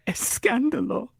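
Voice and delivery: high, dramatic Spanish exclamation; mock Spanish. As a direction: gasp it like a telenovela.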